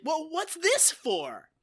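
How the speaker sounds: high, slow, clueless question; mock innocent puzzlement, drawn out; Gomer Pyle. Naive recruit handed a gun, slow innocent puzzlement. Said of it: "he's gonna be like".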